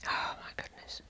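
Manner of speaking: breathless whispered oh my goodness; whispered exasperation. Real, not acted. Struggling with a fiddly clasp, whispering in frustration. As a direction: mutter it under your breath, fed up.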